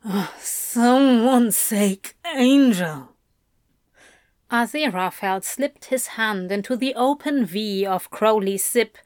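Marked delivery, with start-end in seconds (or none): 0.0-3.2 slow, drawn-out breathy plea; Crowley, slow and desperate. A narrator's reading. Crowley begging his angel, slow. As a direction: draw it out, desperate.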